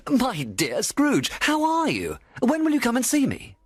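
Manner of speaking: high-pitched, gushing friendly hail; effusive greeter. A narrator's reading. Passer-by stops Scrooge in the street, effusively friendly.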